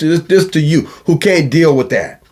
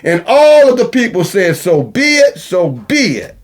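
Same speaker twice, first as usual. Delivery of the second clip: raised, ringing scripture recital; scripture reading; 4 semitones higher than usual. Acted. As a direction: read it out like holy writ.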